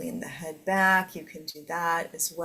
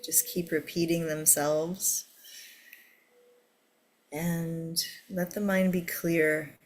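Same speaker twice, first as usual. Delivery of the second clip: guided meditation. Acted. Leads a meditation.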